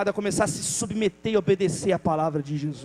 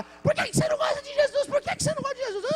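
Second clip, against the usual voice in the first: put-on much higher child voice calling out; girl shouting at the door; faster than usual. Girl shouting at the closed door, high childlike call. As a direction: keep shouting it after him. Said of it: "E ela ficou lá".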